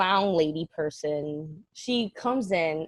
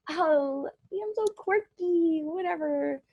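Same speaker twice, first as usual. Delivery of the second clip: mock quirky girl; 10 semitones higher, slower than usual. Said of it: "and I'm like".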